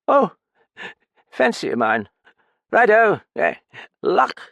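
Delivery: put-on high, loud, slurred drunk; drunken Graffin. Drunk man pleading with narrator, high and slurred. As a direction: play the sodden old pianist rambling.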